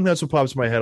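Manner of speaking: usual speaking voice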